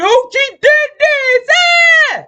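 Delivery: hammed-up imitation of a scandalised accuser; mock outcry. Mimics accusers reporting her.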